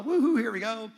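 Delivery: high, loud woo-hoo; party cheer. Acted. Holidaymaker toasting tequila in Mexico, party cheer. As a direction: whoop like the drinks just arrived. Tipsy vacation celebration.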